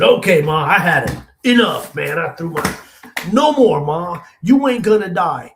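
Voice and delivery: loud, high angry outburst; blown-up tantrum. Retold or imitated. Grandson blowing up at his dying grandmother, angry. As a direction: blow up at her, pitch and volume jumping. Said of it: "I really just blew up".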